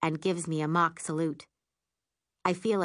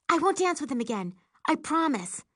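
lightly voiced apologetic friend; 10 semitones higher than usual. A narrator's reading. Tammy apologizing.

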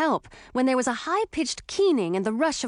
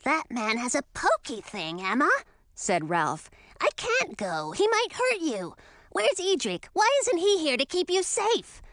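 put-on higher, anxious boyish dragon; worried young dragon; 6 semitones higher than usual. A narrator's reading. Young dragon worries the man will hurt Emma, anxious. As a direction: fret like a scared kid.

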